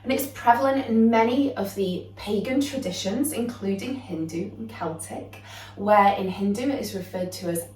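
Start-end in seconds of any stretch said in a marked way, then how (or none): none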